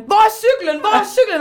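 high-pitched mock cry; retold shouting. Imitating Jesper looking for his bike, retold shouting. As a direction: imitate him calling out urgently. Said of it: "Nogle gange så råber han".

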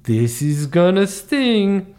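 hammy drawn-out sketch delivery; Buzz the Brazilian bee. Retold or imitated. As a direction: stretch out the punchline in character. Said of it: "That was my catchphrase".

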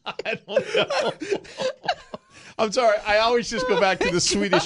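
high, helpless laughter through the words; real laughing fit; laughing hard. Real, not acted. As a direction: apologise while cracking up. Cracking up at own failed accent.

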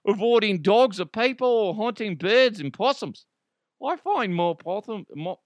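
high, hammed-up Aussie accent; bad Australian accent. Snake catcher Dan quoted to Yahoo News, hammy Australian accent. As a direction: botch a broad Australian accent, pitched well up. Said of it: "I totally screwed up that accent".

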